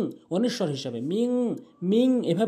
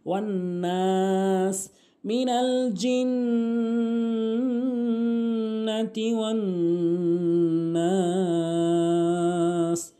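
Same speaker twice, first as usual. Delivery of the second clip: Quran recitation; 3 semitones higher, much slower than usual. Shown as a demonstration. Teacher models the final verse in tilawat. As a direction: chant the closing verse, holding নাস long.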